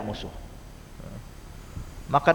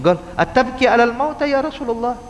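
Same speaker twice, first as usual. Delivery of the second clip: higher, baffled voice of a puzzled companion; incredulous bystander, pitched up; bewildered question; 6 semitones higher than usual. Retold or imitated. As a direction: ask in disbelief, pitched up high. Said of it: "ini bingung".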